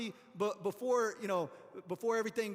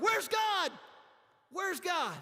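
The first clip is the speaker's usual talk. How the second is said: complaining cry; 6 semitones higher, much slower than usual. Retold or imitated. Hungry Israelites complain.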